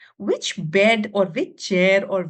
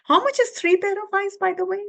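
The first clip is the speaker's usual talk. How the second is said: excited; 10 semitones higher, faster than usual. Real, not acted. Teacher quizzing kids excitedly.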